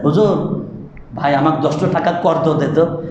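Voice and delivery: "কর্জ" with a heavy Arabic consonant, low pitch; mock Arabic-style "কর্জ". Shown as a demonstration. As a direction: ask for money, mocking the scholarly sound. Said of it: "কর্জ কইছেন কোনোদিন". (আরবি ঢঙে "কর্জ")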